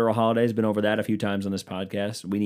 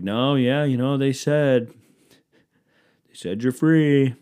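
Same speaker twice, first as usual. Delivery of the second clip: obliging slave owner; 4 semitones higher, 6 dB louder, slower than usual. Acted.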